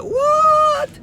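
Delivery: high loud startled yelp; shocked what. Retold or imitated. Father hearing his daughter's news, high startled yelp.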